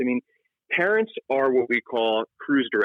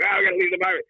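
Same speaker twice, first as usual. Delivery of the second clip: higher-pitched pretend panic; mock freak-out; 6 semitones higher, faster than usual. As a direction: act out a dad losing it. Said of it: "Is he gonna freak out?".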